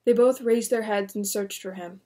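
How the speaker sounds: usual speaking voice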